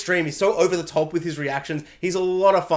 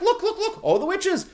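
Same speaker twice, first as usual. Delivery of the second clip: high, laughing excited calls; excited pointing; 11 semitones higher than usual. Retold or imitated. Son showing witches to his father, high excited laughing. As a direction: point them out, thrilled. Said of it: "he's like".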